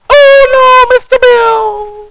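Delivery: very high squealing wail; Mister Bill cry. Acted. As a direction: wail like the clay-figure cartoon in panic. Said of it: "cried with a loud voice".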